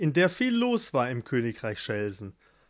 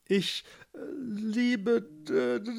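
high, halting lover's stutter; stammered confession; 8 semitones higher, 4 dB quieter, slower than usual. A narrator's reading. Gustav tries to declare love to Barbara, stuttering high. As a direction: get stuck stuttering, fading away. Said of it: "wie Gustav zu Barbara sagte".

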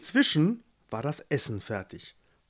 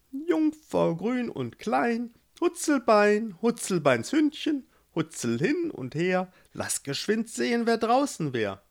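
toad's spell; 10 semitones higher than usual. Acted. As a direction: intone the magic rhyme, much higher. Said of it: "die Itsche sprach wie schon beim letzten Mal". (die Kröte spricht ihren Zauberspruch hoch und langsam)

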